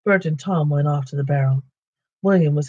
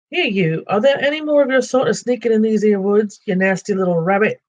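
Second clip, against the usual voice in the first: menacing troll; 7 semitones higher, 3 dB louder than usual. Acted. Troll Bert threatens Bilbo.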